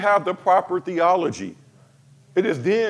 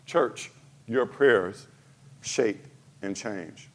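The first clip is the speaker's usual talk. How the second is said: hushed and slow; 10 semitones lower, 7 dB quieter, slower than usual. Real, not acted. Sermon point dropping to hush.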